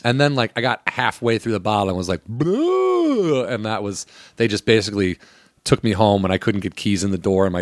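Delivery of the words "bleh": high retching bleh; mock gagging bleh. Drunk teen gagging on vodka, high retch. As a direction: gag on cheap vodka.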